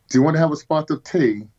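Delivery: a playful fake English accent on the phone; mock English accent. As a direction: caller greeting an editor in a mock English accent. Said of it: "I'd try to give him my best English accent".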